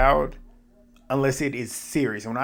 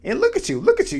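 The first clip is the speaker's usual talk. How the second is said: much higher, mock indignant Michelle; mock scolding; faster than usual. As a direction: imitate her sarcastic scolding.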